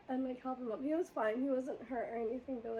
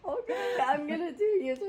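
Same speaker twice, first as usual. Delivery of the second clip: mock reckless driver; 4 semitones higher, 6 dB louder than usual. Imitating driver announcing U-turn.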